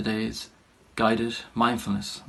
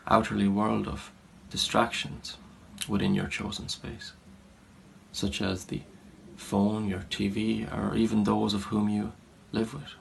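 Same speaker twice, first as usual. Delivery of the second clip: softer, unhurried meditation guidance with long pauses; guided meditation; 6 dB quieter than usual. Real, not acted. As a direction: gently settle listeners into a body scan, leaving space between phrases. Leads guided body scan meditation.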